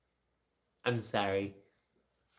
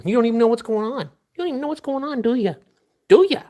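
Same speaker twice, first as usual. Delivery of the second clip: much higher, loud, quick pet voice; 12 semitones higher, 8 dB louder, faster than usual. Real, not acted. Owner teasing her confused dog, loud high baby talk.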